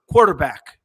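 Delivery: low, deadpan one-word answer. Retold or imitated. Coach answering reporters with one word, deadpan. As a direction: drop it flatly, pitched well down. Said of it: "deadpan, one word".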